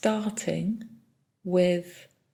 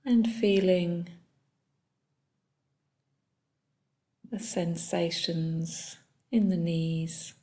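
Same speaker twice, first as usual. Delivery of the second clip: soft, unhurried body-scan guidance with long pauses; calm meditation leader, phrase by phrase; guided meditation; 6 dB quieter than usual. Real, not acted. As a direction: guide them gently through the body, leaving long silences.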